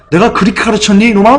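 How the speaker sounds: high, wailing reproach; mock-wail like a grieving apostle; imitating Paul's lament. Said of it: "막 통곡할".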